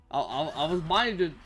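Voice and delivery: ordinary talk, nothing marked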